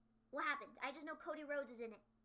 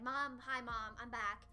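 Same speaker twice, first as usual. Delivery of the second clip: lightly voiced lower greeting; returning daughter imitation; 6 semitones lower, slower than usual. Imagining Maki greeting her mom, low casual imitation. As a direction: drop the pitch, imitating a casual homecoming. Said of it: "looking for her mom to say".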